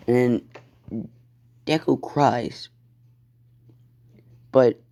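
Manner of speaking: ordinary delivery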